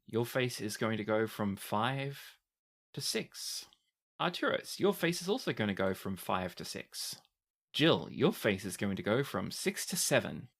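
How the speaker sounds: ordinary talk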